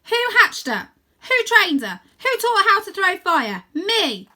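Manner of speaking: high, loud rising questions, landing on Me; defiant shout. Acted. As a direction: proclaim it proudly. Rose proclaims defiantly.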